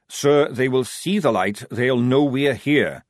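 patronizing. Acted. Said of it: "as though repeating things to a child".

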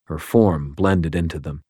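plain reading voice

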